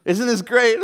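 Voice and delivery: loud, high, gleeful shout; gleeful dad. Retold or imitated. Laughing dad to scared son in the waves, gleeful. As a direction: imitate an exhilarated dad calling over the surf.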